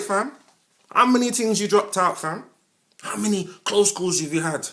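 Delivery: a heated challenge to grown men; heated rant. Real, not acted.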